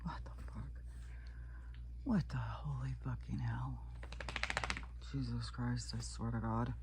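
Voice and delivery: hushed, breathy oh my Lord; whisper. Real, not acted. Vlogger watching a disgusting clip, whispering. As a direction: mutter it under your breath in disbelief.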